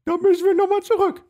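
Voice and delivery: hohe Oma-Stimme; alte Frau Stimme. Retold or imitated. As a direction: piepsig wie eine alte Dame. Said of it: "Und die Frau so". (hohe Stimme einer alten Frau)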